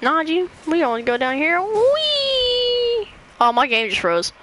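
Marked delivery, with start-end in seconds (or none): 1.6-3.1 high drawn-out whee of delight; gleeful whee. Real, not acted. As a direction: squeal like on a rollercoaster. Riding down the slope.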